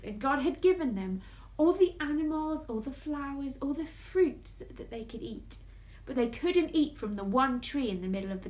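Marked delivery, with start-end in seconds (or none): none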